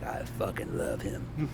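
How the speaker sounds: hushed whisper of the curse; mock whispered swear; whispered quote. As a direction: whisper it like a secret. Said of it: "she said effing".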